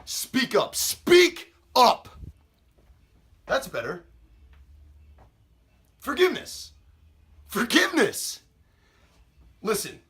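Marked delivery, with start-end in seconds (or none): 0.8-2.1 sudden loud, high-pitched order; shouted demand. Acted. Same demand repeated, escalated to shout. 6.0-6.7 high-pitched questioning repeat; incredulous echo. Acted. Captor echoes victim's plea. 7.5-8.4 high, emphatic repeat of the plea; scornful exclamation. Acted. Captor repeats the plea, escalating.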